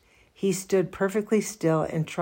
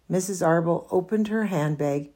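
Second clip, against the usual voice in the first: usual speaking voice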